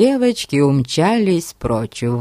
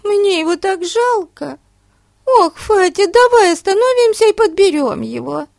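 put-on high, pleading girl voice; young girl voice; 3 dB louder than usual. A narrator's reading. Betsy's voice continues.

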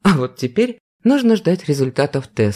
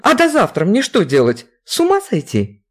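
exasperated; 8 semitones higher than usual. Acted. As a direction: rant at the endless waiting. Vera exasperated by delay.

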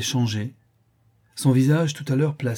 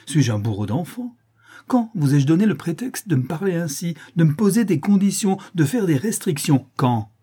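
indignant volley of questions, climbing higher; exasperated; 6 semitones higher, 4 dB louder than usual. A narrator's reading. Countess interrogates her silent niece, exasperated. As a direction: fire off outraged questions, building in pitch. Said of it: "s'écria la comtesse exaspérée".

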